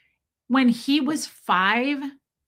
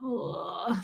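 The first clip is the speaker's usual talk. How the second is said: low, soft "ugh"; overwhelmed groan; 5 semitones lower, 8 dB quieter than usual. Retold or imitated. Reacting to kids screaming in public, overwhelmed groan. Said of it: "I just get overwhelmed by it".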